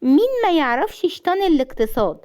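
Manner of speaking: high, sarcastic mimicry; mocking Rawan. A narrator's reading. Jealous wife parodying her rival, high and mocking. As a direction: ape her gushing in a high mock voice. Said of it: "عشان تقلدها بسخرية".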